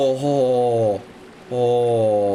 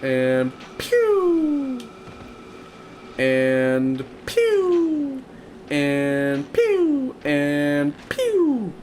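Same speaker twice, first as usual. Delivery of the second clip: pew sound effect; 11 semitones higher, much slower than usual. Pretend. Firing in game with sound.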